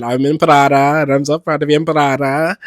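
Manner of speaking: loud imitation of a Puerto Rican accent; fond, loud Puerto Rican accent imitation. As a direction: proudly mimic a Puerto Rican star's accent. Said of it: "I love his accent".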